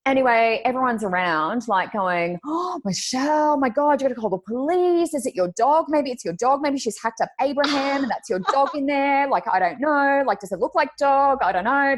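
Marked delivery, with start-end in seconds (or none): 2.5-12.0 alarmed colleagues. Retold or imitated. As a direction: imitate coworkers fussing in rising panic. Colleagues' panic acted out.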